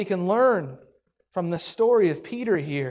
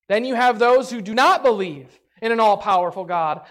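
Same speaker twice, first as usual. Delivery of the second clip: ordinary talk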